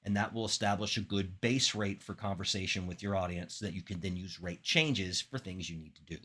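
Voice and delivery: ordinary talk, nothing marked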